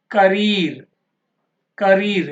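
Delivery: career punched loudly on reer; last-syllable stress. Shown as a demonstration. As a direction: hit the final reer of career hard. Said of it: "last syllable". (career లో చివరి reer పై గట్టి ఒత్తిడి)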